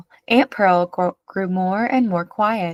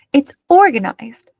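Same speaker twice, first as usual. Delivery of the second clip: indignant; 6 semitones higher, 7 dB louder than usual. A narrator's reading. Pearl rebuts the children.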